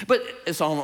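ordinary talk

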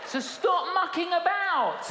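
high, loud laughing rebuke; preacher yelling half in jest; laughing shout. Acted. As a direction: scold them with a grin. Preacher scolds congregation, laughing.

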